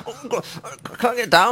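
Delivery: high-pitched mock panic; hammed-up distressed plea; stuck Father Christmas. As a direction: play someone dangling and helpless. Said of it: "and he's like".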